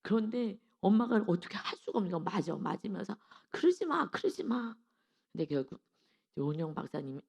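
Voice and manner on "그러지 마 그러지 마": high, desperate mock plea; retold pleading, higher. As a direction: beg the child to stop. Mother pleading while being hit.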